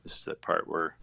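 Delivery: hushed whisper while focusing. Real, not acted. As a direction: whisper it so as not to break concentration. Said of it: "everything goes silent".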